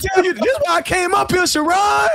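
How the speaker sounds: high-pitched hyped-up reveal; excited announcement. Real, not acted. Gleeful build-up to the gossip.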